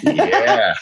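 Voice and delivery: high laugh breaking through the yeah. Real, not acted. Laughs at answer.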